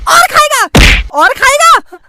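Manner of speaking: mother punishing her son; angry mother. Acted. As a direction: hit each word like a slap.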